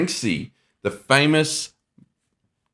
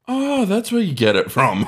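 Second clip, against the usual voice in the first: teasing daughter quote; 9 semitones higher than usual. Retold or imitated. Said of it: "Ellie's like".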